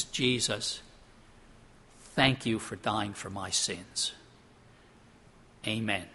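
low, soft, slow prayer; reverent, hushed gratitude; hushed prayer of thanks. Acted. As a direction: quietly thank Jesus, lowering the voice. Models a heartfelt prayer of thanks.

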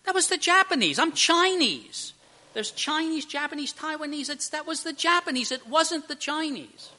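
high-pitched mock indignation; acted indignant protest. Chinese passenger protests false blame in joke.

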